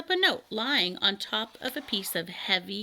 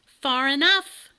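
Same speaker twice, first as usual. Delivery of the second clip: loud slow call to the dragon; yelling; 6 semitones higher, 8 dB louder, slower than usual. Acted. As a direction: shout it across the clearing. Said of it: "yelled Elmer".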